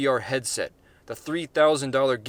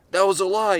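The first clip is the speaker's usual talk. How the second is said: higher, louder yell; 8 semitones higher, 7 dB louder than usual. Acted. Gary yells at the crowd, loud. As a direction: shout the denial back. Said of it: "he yelled".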